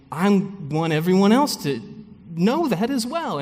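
high-pitched, eager outburst; bursting to tell everyone; acted-out excited eagerness; eager enthusiasm. Acting out eagerness to share.

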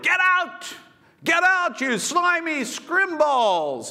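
high, loud hammed-up yell; mock shout. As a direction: bellow them out in mock fury. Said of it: "not Jesus shouted".

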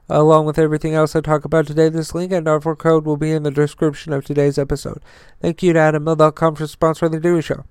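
rapid-fire fast ad read; auctioneer. Acted. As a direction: rattle it off like an auctioneer. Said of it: "I sound like an auctioneer".

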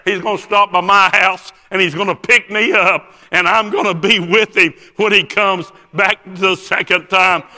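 loud, high, driving declaration; fervent preaching. Retold or imitated. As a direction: proclaim the promise at full force. Preacher retells his fervent reply.

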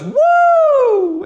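very high, loud woo; piercing high cheer from the bleachers; fan whoop. Retold or imitated. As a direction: whoop like a rowdy fan in the stands. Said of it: "And then he goes".

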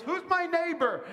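mock lawyer question. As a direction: squeak out "neighbor" like a cornered lawyer. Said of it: "so he goes".

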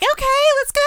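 mock girlfriend. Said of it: "And she's like".

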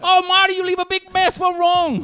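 high, loud mock Chinese accent; Mickey Rooney impression. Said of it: "does Mickey Rooney in Breakfast at Tiffany's".

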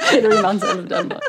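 repeating the quip through laughter; echoing a friend's joke while laughing. Real, not acted. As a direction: laugh the words out.

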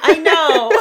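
a real laugh breaking through the words. Real, not acted. Laughing while answering.

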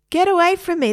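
high, loud cry to back off; alarmed protest. Acted. Boy fending off nail polish remover, alarmed protest.

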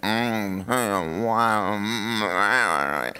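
uneasy, reluctant hums; squirming hums. Retold or imitated. Squirming churchgoer caught by a scripture, uneasy hums. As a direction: hum like you're dodging the point. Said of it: "you sit there and squirm. You're like".

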